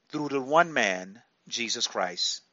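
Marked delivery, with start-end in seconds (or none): none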